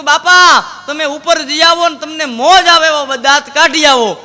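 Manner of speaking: loud, high teasing call to grandpa; hammed-up shout telling him to go laugh upstairs; playful loud nudge to an old man; mock cheerful call. Teller mocks grieving bapa loudly.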